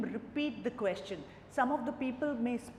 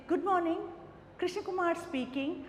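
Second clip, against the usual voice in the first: higher, eager phone greeting; enthusiastic greeting; 6 semitones higher than usual. Shown as a demonstration. Candidate greeting the interviewer, keen and energetic. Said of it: "that shows your enthusiasm".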